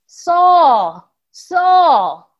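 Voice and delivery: loud booming call from above; voice from heaven calling. Acted. Heavenly voice calls down to Saul on the road, loud booming. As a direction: call his name out loud, twice. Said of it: "a voice called to him saying".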